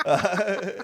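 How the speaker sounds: high burst of laughter; laughing. Real, not acted. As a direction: crack up laughing. Laughing at blob nickname.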